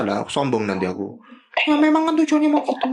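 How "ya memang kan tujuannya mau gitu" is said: quick cartoon sidekick jab, pitched way up; high sidekick voice teasing the co-host; Bobi voice. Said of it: "Bobi tanya lagi deh".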